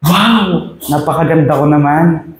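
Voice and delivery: high delighted wow; amazed teacup. A narrator's reading. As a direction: marvel at own beauty. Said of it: "sabi ng teacup". (mataas at namamanghang tuwa)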